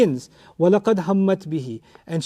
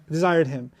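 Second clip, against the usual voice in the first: usual speaking voice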